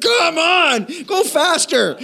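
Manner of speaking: high-pitched, laughing hurry-up at the TV; exasperated urging. Retold or imitated. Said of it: "and you're like".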